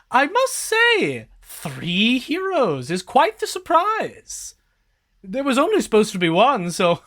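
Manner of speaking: a hint of a theatrical sorceress greeting children; witch voice. The witch greets the three heroes, amused and theatrical.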